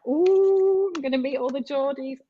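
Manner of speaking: high thrilled "Ooh" then eager rush; mock-giddy anticipation; excited squeal. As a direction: gush like a fan about to meet idols. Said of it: "and I was like".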